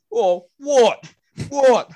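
loud, high challenging what, what; mock tough shout. Big Owen shouting over the floored man, a loud high challenge. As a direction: square up and bark it like a bloke spoiling for a fight. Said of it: "standing over him going".

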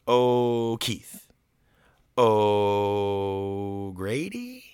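long stalling oh, then a guess; groping for names. Retold or imitated. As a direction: stretch the oh while guessing at a name. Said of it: "he starts naming, like, Irish sounding names".

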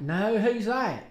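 slow, lightly put-on southern drawl; southern accent. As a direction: imitate relatives in a southern accent. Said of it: "giving them southern accents".

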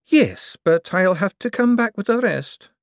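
put-on a young buyer pushing back; young man's voice. Young man insisting to the old forger, impatient.

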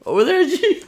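high, loud mock uncle pointing. Uncle telling nephew where he lay, high and loud. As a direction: pitch it way up as the uncle. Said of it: "He's like".

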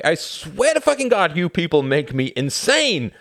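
furious, high, loud shout; harsh outburst. A narrator's reading. Tom pulling away from his friend, shouting harshly. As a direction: lose your temper completely. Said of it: "never spoken to him harshly".

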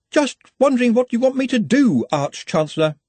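high-pitched anxious underling; hasty innocent cover-up. A narrator's reading. Ponder covers his muttering before the boss, high and hasty. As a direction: squeak out an innocent query.

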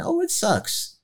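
high, whiny mock moan; mock player complaint. Player at the agent's gala, complaining about Washington, high mock whine. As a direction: squeal it like a fed-up player griping. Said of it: "I'm like".

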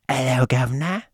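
hammed-up mock English greeting; badly faked English accent, played stupid. Mocking English listeners for a laugh, silly English accent. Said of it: "I'm not good with that accent".

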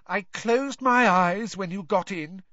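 put-on high, whiny girl ghost; Myrtle's ghost voice. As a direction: pitch up into a mopey schoolgirl ghost.

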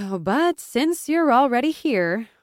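sighing resignation. A narrator's reading. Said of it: "She sighed".

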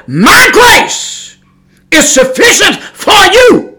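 booming high voice of God; voice of the Lord. Retold or imitated. Preacher booming the Lord's answer to his prayer. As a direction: thunder it out as the Lord speaking.

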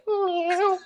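high mock cat meow; a quick kitten meow. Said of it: "I could just see, like".

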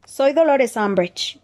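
high, slow, squeaky and scared; high-pitched nervous Umbridge; shrill frightened voice. A narrator's reading. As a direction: squeak it out, hiding fear. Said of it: "con una voz chillona que delataba su miedo".

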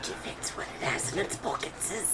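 very soft creature-like whisper; hissed whisper. Acted. Game master butting in about pockets, sly whisper. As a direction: whisper it low and sneaky.

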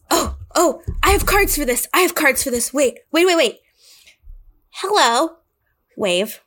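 a tiny creature proudly introducing himself; bright squeaky greeting; cute little kobold. Acted. Player voices cute dragon kobold.

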